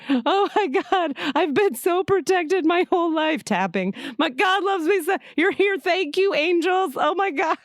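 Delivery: laughing-crying thanks to God and angels; joyful relief. Retold or imitated. Realizing she is protected, thanking angels in high joyful relief. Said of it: "I'm hysterically laughing".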